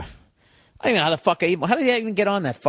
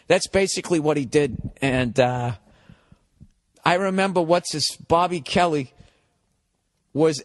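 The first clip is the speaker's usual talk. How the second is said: ordinary delivery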